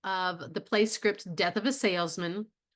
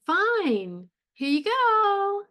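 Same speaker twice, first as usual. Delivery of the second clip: bright cheerful rising, much higher and louder; sincere sweet; 12 semitones higher, 5 dB louder, much slower than usual. Shown as a demonstration. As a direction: say it sweetly, genuinely, pitch high. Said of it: "Is she saying".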